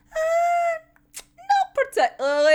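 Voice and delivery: a smug, high lie as Fyodor; smirking Fyodor. Retold or imitated. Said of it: "a wee kinda smirk when he's just like".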